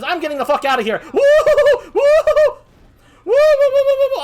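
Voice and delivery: high, loud hysterical whooping; laughing, panicked hoots; frantic fleeing. Acted. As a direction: whoop wildly as you run away.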